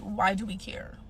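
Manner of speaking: usual speaking voice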